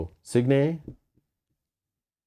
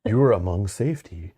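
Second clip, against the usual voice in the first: cult leader. Pretend. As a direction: lull them into a trance. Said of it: "You sound like a cult leader".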